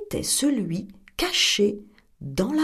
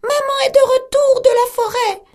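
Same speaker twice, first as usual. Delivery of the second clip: high, loud fake mother voice; the wolf calling sweetly through the door; wolf faking mother goat at the door, high; wolf's sweet call; 13 semitones higher, 7 dB louder than usual. A narrator's reading. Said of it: "et cria".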